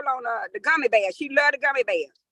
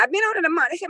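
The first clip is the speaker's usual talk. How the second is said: ordinary delivery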